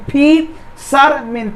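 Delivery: Fisherman slowly, pausing between फि, शर, मैन; three long separated beats on fisherman; split into syllables. Shown as a demonstration. Teacher showing students three syllables, split into syllables. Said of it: "उच्चारण करके देखना है".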